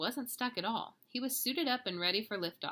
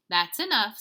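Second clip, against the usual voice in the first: mild, loud motherly scolding; scolding mama; 3 semitones higher, 11 dB louder than usual. Acted. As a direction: cut the teasing off with a touch of sternness. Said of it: "scolded Mama".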